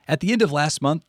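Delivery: ordinary delivery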